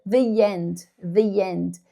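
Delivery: "the end" joined by a soft y sound; y-linked the end. Shown as a demonstration. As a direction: glide from "the" into "end" with a y. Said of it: "um sonzinho de Y". ("the end" com sonzinho de i ligando)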